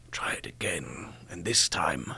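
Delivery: put-on old Shakespeare coach whispers his note. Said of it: "he whispered it".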